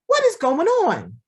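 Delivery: high, emphatic mock disbelief; incredulous protest. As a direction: voice a baffled objector, pitched right up. Voicing people protesting God's harshness.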